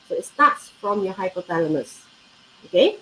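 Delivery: normal talking voice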